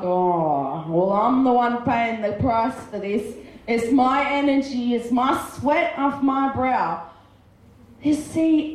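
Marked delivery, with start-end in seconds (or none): 0.0-7.0 low, put-upon complaint; acted resentment, opening on a deep groaned "oh"; grumbling son. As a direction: grumble like the hard-done-by son.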